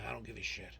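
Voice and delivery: ordinary talk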